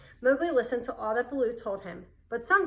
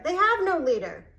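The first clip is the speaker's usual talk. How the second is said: firm; 7 semitones higher, 7 dB louder than usual. A narrator's reading. Panther sharply contradicts boy.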